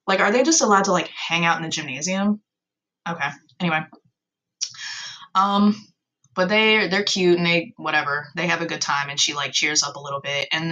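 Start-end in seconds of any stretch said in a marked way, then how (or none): none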